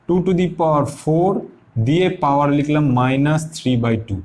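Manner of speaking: dragged-out dictating pace; slow dictation. Shown as a demonstration. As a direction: say it slowly so students can write it down. Teacher dictating while writing.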